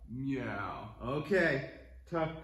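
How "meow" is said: low, drawn-out meow; cat meow. Shown as a demonstration. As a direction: echo a lower, long meow. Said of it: "Cat pose".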